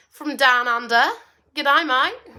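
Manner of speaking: hammed-up Australian twang; terrible Australian accent. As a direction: put on a bad Aussie accent for a greeting. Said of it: "sorry about the terrible accent".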